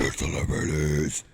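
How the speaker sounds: very low, growled title echo; hammed-up deep segment call. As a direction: answer in a monster announcer's depth.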